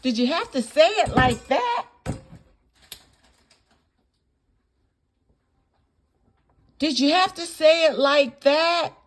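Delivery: high-pitched, incredulous demand; indignant challenge. Real, not acted. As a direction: repeat it as an outraged, scolding question. Indignantly challenging how it was said.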